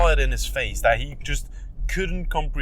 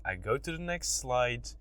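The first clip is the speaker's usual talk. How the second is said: ordinary talk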